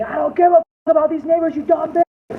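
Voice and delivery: loud, high angry yell; imitated screaming. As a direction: scream it like an enraged husband. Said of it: "and he's screaming".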